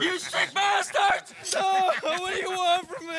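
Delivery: desperate terrified yell; panicked shout. Acted. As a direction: scream it at your captors in terror. Captive about to be gelded.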